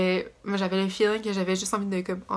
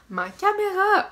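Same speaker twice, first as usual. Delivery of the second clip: excited; 12 semitones higher, 4 dB louder, slower than usual. Real, not acted. Big news, camera repair.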